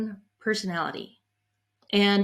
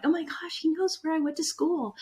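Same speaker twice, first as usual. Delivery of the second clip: starstruck; 7 semitones higher, 5 dB quieter than usual. Retold or imitated. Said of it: "And I'm like".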